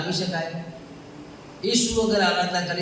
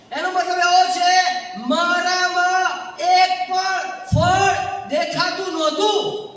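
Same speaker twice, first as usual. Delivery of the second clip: loud, raised pulpit cry; preaching at full force; 13 semitones higher, 6 dB louder, slower than usual. Acted. Preacher confessing to congregation, loud and raised. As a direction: build to a shout for the church.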